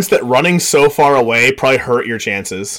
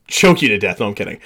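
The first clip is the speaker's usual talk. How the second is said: ordinary talk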